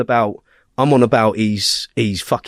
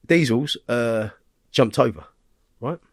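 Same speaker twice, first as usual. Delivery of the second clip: usual speaking voice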